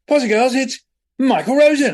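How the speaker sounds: loud, high school-assembly announcement; announcing loudly. Retold or imitated. Teacher presenting a guest poet to the school, loud and high. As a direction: call it out to the whole school.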